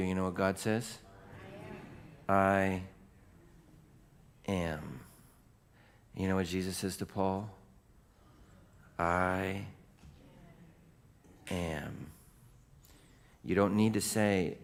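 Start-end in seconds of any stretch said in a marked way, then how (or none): 2.3-5.0 two words split by a long pause; solemn declaration. Acted. Preacher as God answering Moses, solemn and spaced out. 9.0-12.1 soft, weighty, long pause between words; solemn declaration. Acted. Preacher as Jesus answering Paul, slow solemn softness.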